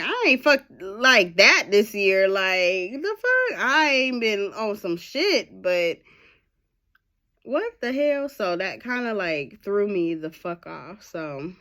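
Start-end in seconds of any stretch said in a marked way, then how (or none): 0.0-8.3 higher and louder, disbelieving; incredulous retort. Retold or imitated. Retelling her shocked reply to the man, incredulous. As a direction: push back in shocked disbelief.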